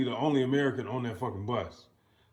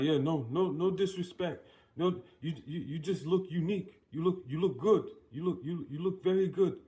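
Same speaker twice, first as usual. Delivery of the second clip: imitating a stranger, higher and stumbling; higher-pitched, halting appeaser; flustered placating; 5 semitones higher than usual. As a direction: act out nervous backpedaling with compliments. Voices stranger placating him with compliments.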